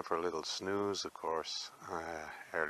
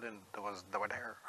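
usual speaking voice

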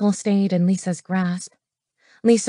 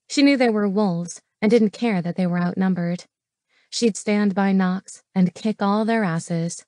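plain reading voice

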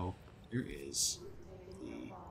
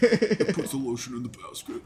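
louder creepy killer impression; Buffalo Bill voice; 3 semitones higher, 8 dB louder than usual. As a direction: purr it like the movie villain. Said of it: "Buffalo Bill voice".

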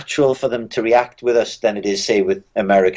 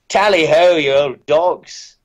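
a hammed-up English toff greeting, loud then slow; mock posh British; 7 semitones higher, 3 dB louder, slower than usual. A comedian on stage hamming up a toff British accent. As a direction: overplay a British toff for an American crowd. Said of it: "go on stage and go".

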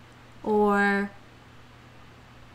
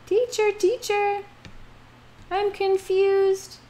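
high-pitched pupil asking for help; confused pupil; 9 semitones higher than usual. Shown as a demonstration. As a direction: call to the teacher in a small, high voice. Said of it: "I ask for help".

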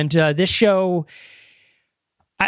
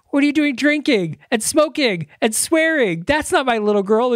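high-pitched mock outrage, item by item; imitating scandalized dad; 7 semitones higher than usual. Dad appalled by his little girl's vices, mock scandalized. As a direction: act out an appalled father's scolding. Acts dad scolding wild daughter.

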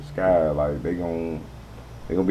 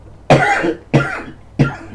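hoarse coughing after a screech; strained coughing fit; 7 dB louder than usual. Real, not acted. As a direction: cough like your throat is raw.

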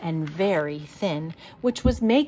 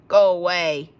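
growled; 2 semitones lower, 5 dB louder than usual. A narrator's reading. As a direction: growl it like a grumpy bear. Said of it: "he growled".

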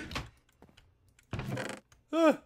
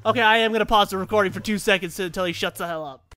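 ordinary talk, nothing marked

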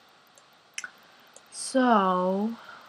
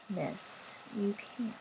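whisper; 11 dB quieter, slower than usual. Acted. Whispering to sim.

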